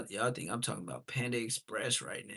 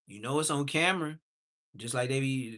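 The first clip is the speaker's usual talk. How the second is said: ordinary talk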